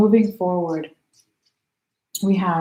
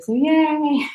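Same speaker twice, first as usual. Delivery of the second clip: high, excited little cheer far above her usual pitch; excited squeal; 9 semitones higher than usual. Real, not acted. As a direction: squeal with delight at sharing the sign. Cheering, she is Sagittarius too.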